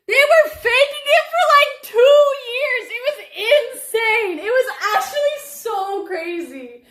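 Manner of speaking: laughing in disbelief at the memory. Real, not acted. Laughing about catfish.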